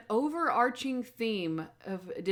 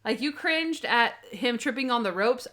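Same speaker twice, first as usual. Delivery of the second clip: usual speaking voice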